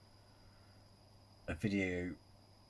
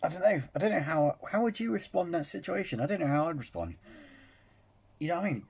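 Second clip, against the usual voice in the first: amazed; 8 semitones higher, faster than usual. Real, not acted. Reactor stunned by footage.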